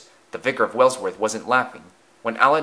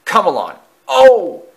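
snarl turning to shock; 10 semitones higher, 11 dB louder than usual. Acted. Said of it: "snarled Aled".

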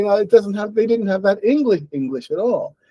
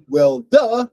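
mock sarcasm; 8 semitones higher, 4 dB louder than usual. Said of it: "And he says".

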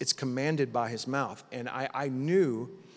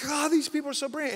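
high, quick burst of awe; amazed exclamation; 11 semitones higher, faster than usual. Retold or imitated. Acts out staggered amazement.

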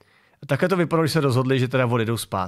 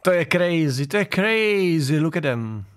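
higher, drawn-out mimicry; mock amazed onlooker; 4 semitones higher, slower than usual. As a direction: stretch it out in mock awe. Mimics woman in played clip.

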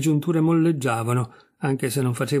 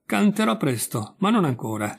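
ordinary delivery, nothing marked

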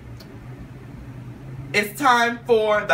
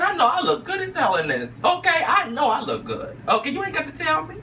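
sassy confidence; 10 semitones higher than usual. Retold or imitated. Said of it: "I said".

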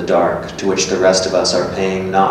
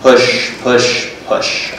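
urging push; 4 semitones higher, 4 dB louder, much slower than usual. Retold or imitated. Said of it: "I remember she said".

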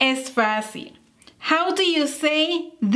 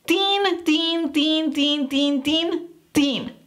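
"tin" said short and firm, over and over; forceful tin; 3 semitones higher, slower than usual. Shown as a demonstration. Teacher chanting the ending for students. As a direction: hammer out "tin" like a drumbeat. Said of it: "enfatizar y decir con fuerza esta terminación".

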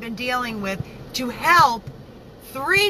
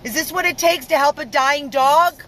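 higher and louder, real anger near tears; angry outcry; 5 semitones higher, 5 dB louder than usual. Real, not acted. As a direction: cry it out at the injustice. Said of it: "I'm so angry".

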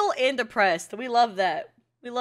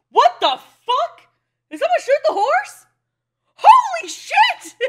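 high, loud shocked outburst; 13 semitones higher, 9 dB louder than usual. Real, not acted. Viewer watching the horse get shot, shocked shout. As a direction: yell out in disbelief, slow and high.

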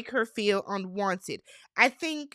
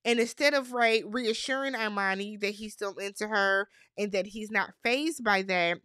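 ordinary delivery

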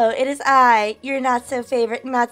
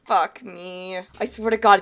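normal talking voice

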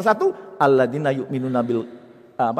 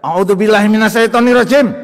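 loud, high shouted prayer; mock furious invocation; unmindful angry recitation; 7 semitones higher, 10 dB louder than usual. Shown as a demonstration. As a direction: bellow the invocation as if still furious.

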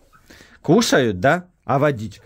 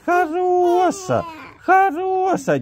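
slow, high squeaky dog praise; squeaky praising a dog; much slower than usual. Real, not acted.

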